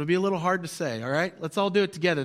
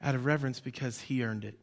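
hushed refrain; 7 semitones lower, 7 dB quieter than usual. Shown as a demonstration. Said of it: "repeated refrain".

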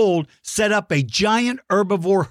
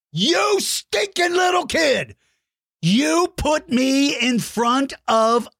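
high, slow mock yell; hammed-up outrage at the kid; mock angry shout; 9 semitones higher, slower than usual. Mock outrage at kid's drawing.

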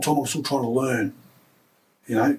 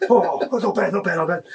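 a biased mum, pleased and pitched up; proud mum; 4 semitones higher than usual. Retold or imitated. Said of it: "She goes".

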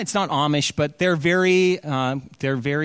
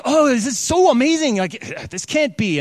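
much higher and louder, delighted; effusive praise; 10 semitones higher, 5 dB louder than usual. Retold or imitated. General marvelling at the wine, loud and delighted. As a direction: rave about the wine, overwhelmed. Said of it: "he's just effusive with his praise".

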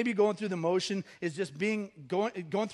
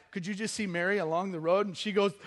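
ordinary delivery

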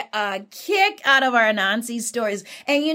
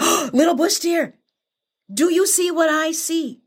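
high, mock-amazed exclamation; feigned amazement; 6 semitones higher than usual. Anansi points Bush Deer to the rock, acted amazement. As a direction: lure the listener with playful wonder.

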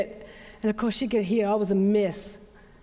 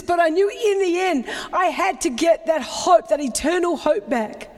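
preacher at full force, voice raised high; full-force preaching; 6 semitones higher than usual. Acted. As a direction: pour the grief out to the congregation. Retelling grief drive at full force.

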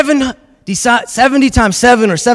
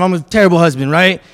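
normal talking voice

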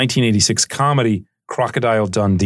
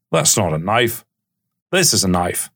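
put-on terrible Australian accent; 2 semitones lower than usual. Dundee facing down a young mugger, terrible Australian accent. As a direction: do a hammy Australian movie-hero accent. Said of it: "my terrible Australian accent".

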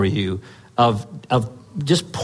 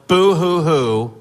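slow, mocking fake sob; hammed-up mock crying; mock boo-hoo sobbing; 4 semitones higher, 3 dB louder, slower than usual. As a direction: sneer out a pretend boo-hoo. Mocking view of Jesus' death.

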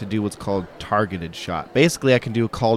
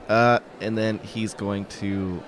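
normal talking voice

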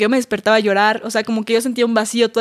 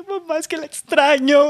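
higher and slow, a mock whimpering plea to mom; imitated crying plea; 4 semitones higher, slower than usual. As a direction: whine it like a heartbroken daughter retelling. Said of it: "así que llorando". (súplica llorosa imitada, más aguda y lenta)

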